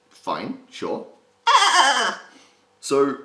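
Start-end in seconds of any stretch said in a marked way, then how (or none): 1.5-2.2 very high, loud, rattling cartoon laugh; loud staccato squeal of put-on laughter; SpongeBob laugh. Shown as a demonstration. Coach demonstrating the easy laugh, SpongeBob cackle. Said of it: "So the laugh is simple".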